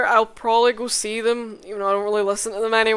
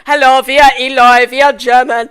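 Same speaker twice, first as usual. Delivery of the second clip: loud mock German accent; 13 dB louder than usual. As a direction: announce it like a cartoon German. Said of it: "German be like".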